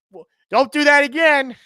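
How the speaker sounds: loud, high playful telling-off; mock scolding. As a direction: pretend to tell off your team. Jokingly scolds his writing team.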